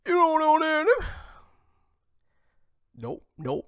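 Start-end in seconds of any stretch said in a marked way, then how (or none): 0.1-1.1 high-pitched, loud put-on yell; high mock shout. Host heckling in mockery, high mock shout.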